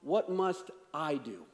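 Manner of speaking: "I" stressed, the question drawn out slowly; slow down and lean on "I". Shown as a demonstration. Pastor showing where the emphasis is, stress on "I". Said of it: "The emphasis is on".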